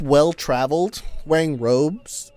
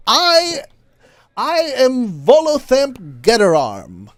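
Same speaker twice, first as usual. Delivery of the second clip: high, slow, self-important introduction; pompous high old traveler; grand old wizard intro; 8 semitones higher, slower than usual. Acted. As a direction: announce your name like it should impress. GM voicing Volo introducing himself.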